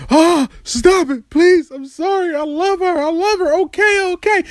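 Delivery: high, pleading cry of surrender; desperate pleading. Retold or imitated. Arguing with his own heart, high desperate pleading. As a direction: beg yourself to stop, voice climbing high. Begging himself to stop loving her.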